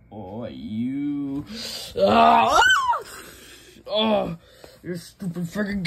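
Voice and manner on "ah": loud anguished yell; pained shout. Pretend. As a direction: cry out as if hurt. Pain cry in fight.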